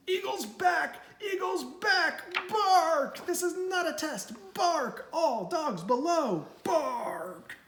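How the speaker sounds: high, urgent barking alarm; watchdog alarm. Acted. Big Bark warning all dogs below, high urgent barking.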